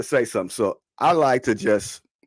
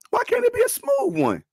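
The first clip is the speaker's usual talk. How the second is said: exasperated high plea. Real, not acted. Pleading for an easy playoff road.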